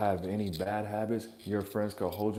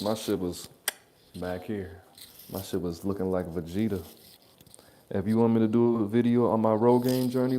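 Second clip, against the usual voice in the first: usual speaking voice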